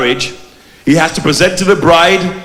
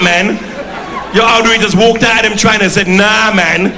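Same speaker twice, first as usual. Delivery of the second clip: Brixton geezer character; 4 semitones higher than usual. Acted. Devil Wilkins addressing the audience, in a brash Brixton character voice.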